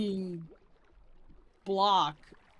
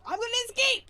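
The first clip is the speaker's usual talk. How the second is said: squeaky high little creature voice; playful critter voice; 12 semitones higher, 4 dB louder, much faster than usual. Pretend. Streamer voicing axolotl on glass edge, playful squeak. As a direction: voice the axolotl's daring escape.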